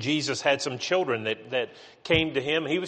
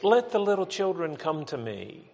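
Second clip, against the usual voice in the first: gentle, higher-pitched scripture reading; soft, gentle; 5 semitones higher than usual. Acted. The way we read Jesus welcoming children, soft and gentle. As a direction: lift the pitch and say it kindly, unforced. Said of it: "hear that soft, gentle voice of Jesus".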